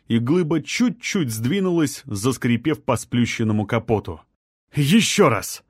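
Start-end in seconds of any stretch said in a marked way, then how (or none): 4.7-5.6 straining shout. A narrator's reading. Lifting the slab together.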